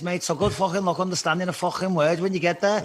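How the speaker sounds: showing off a thick Scouse accent. Shown as a demonstration. Scouse accent to warn Americans about Liverpool. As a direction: lay on the Liverpool Scouse, cheeky. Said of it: "And they all fucking talk like this".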